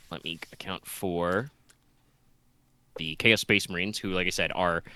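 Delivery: normal talking voice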